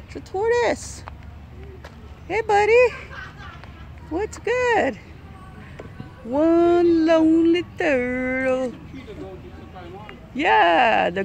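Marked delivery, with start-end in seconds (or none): 2.3-5.0 pet talk. Real, not acted. Greeting an animal at the zoo. 6.2-8.7 drawn-out mock pity. Echoing friend's remark about turtle.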